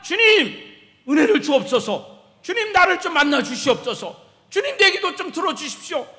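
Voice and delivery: loud, high pleading prayer; acted desperate shout of supplication; earnest crying out. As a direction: cry out to the Lord with urgency. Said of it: "간절히 부르짖고 소리침으로".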